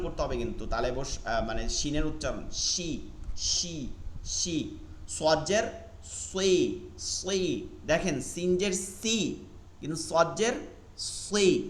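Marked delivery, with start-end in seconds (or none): 6.3-7.7 সুই slow, heavy and thick; heavy sad kasra. Shown as a demonstration. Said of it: "হুরুফুল ইস্তিআলা, মোটা". (ধীরে মোটা করে সুই)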